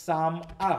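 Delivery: "সাম আ" as two separate syllables, single m; "সাম আ" broken apart with one m sound; split into syllables. Shown as a demonstration. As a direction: say "সাম", then a detached "আ". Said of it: "সেটা single উচ্চারিত হয়".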